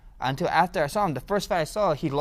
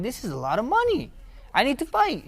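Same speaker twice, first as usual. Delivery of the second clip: excited realization; 11 semitones higher than usual. Retold or imitated. Teen decides to fight for money, thrilled. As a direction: sell the sudden dream of money. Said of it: "I'm like".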